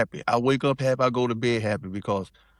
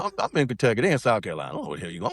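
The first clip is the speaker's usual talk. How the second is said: ordinary delivery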